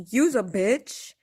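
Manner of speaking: "Use a bitch" tossed out lightly, slow and loud; casual use a bitch. Shown as a demonstration. Explaining her casual way of swearing, a loose offhand "Use a bitch". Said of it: "But when I say".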